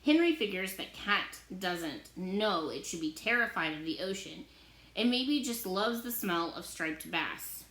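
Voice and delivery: plain reading voice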